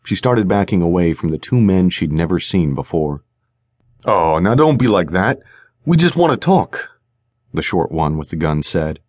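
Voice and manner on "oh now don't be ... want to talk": sleazy thug. Acted. Thug menaces Stormy.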